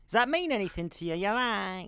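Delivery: mock psychic medium, quick and probing; fortune teller. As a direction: ham up a stage psychic reading. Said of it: "you sound like a psychic".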